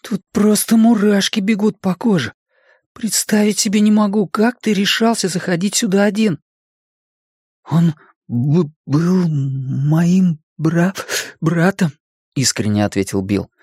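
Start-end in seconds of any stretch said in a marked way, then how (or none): 0.0-6.4 put-on high-pitched, creeped-out boy; spooked. A narrator's reading. Richie tells Bill the room gives him goosebumps, spooked. 7.7-11.9 halting, higher-voiced stammer; stuttering. A narrator's reading. Bill speaks of his dead brother, stuttering.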